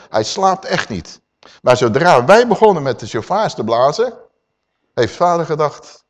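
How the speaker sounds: usual speaking voice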